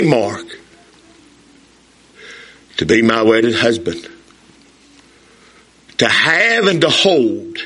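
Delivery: slow, low, solemn vow recital. Retold or imitated. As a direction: say the vows with deep sincerity at the altar. Bride's vows recited.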